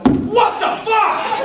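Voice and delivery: high, slow squeal of outrage; a creature's shocked, drawn-out cry; startled Bigfoot. Retold or imitated. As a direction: yelp like a beast hit on the head. Bigfoot hit by a rock.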